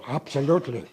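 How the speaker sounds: Absolutely said loud and firm, falling; emphatic yes. Real, not acted. Answering the interviewer, emphatic Absolutely. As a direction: land Absolutely hard.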